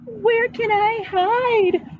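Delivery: put-on high, slow frightened child; a scared little boy looks for somewhere to hide; panicked boy. A narrator's reading. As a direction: plead for a place to hide. Scared boy seeks hiding.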